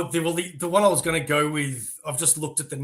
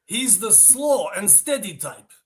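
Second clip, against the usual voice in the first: put-on slow Russian accent, pitched up; 5 semitones higher, slower than usual. Student playing the guy sizing up his rival, Russian accent. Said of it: "if I have to do a Russian accent".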